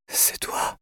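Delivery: faint, voiceless whisper; softly whispered, feeble voice; weak whisper. A narrator's reading. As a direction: whisper it with hardly any strength. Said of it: "chuchota-t-elle d'une voix faible".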